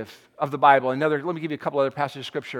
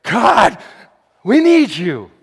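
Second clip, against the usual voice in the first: loud raw plea; raw cry to God; 5 semitones higher, 9 dB louder, much slower than usual. Acted. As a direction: blurt it out, not pretty. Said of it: "Not too pretty, I'm just".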